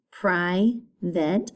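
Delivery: "private" broken as pri... vate; long I, then a gap before vate in "private"; split syllables. Shown as a demonstration. Teacher having pupils listen to the I, "private" split in two. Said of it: "Two syllables".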